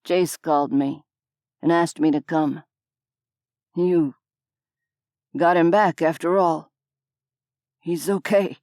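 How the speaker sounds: low man's voice, faint tremor; slight tremor. A narrator's reading. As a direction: let the last word wobble. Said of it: "a faint tremor behind the final word".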